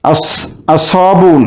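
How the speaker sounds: slow "আসবুন" as as-sawbun, no L; silent lam. Shown as a demonstration. Teacher showing students the silent lam in a slow "আসবুন". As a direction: hold the doubled S in "আসবুন". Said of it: "লাম উচ্চারণ হচ্ছে না".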